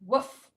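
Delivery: short loud woof; puppy bark. Pretend. Voicing the book's puppy, short punchy bark. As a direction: bark like a little dog.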